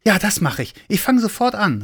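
high, eager, rushing to start; high excited cry of a small helper; eager call. A narrator's reading. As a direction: call out keenly, a little child. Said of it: "rief der kleine Weihnachtsmann".

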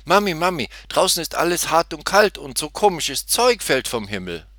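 put-on high, loud, breathless-with-news child voice; excited piglet. A narrator's reading. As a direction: burst out to mummy with the news. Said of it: "Aufgeregt rannte sie zu ihrer Mutter".

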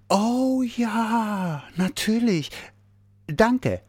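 high, drawn-out dawning delight; delighted realisation. A narrator's reading. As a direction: let it dawn, then say it gladly. Sudden understanding of the missing word. (helle, freudige Erkenntnis)

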